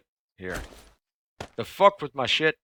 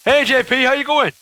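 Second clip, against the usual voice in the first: high, loud excited hello; cheery greeting; 10 dB louder than usual. Real, not acted. Gamer greeting a friend who joins, loud excited hello. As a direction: call out a big welcome.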